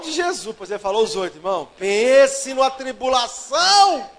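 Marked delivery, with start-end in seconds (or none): 1.8-4.1 slow shouted exclamation. Real, not acted. Preacher telling the church about his wedding, slow shout. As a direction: shout it out slowly, stretching every word.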